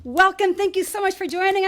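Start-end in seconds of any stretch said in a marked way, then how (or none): none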